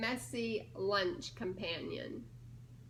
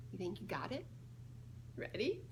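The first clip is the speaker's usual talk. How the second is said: plain reading voice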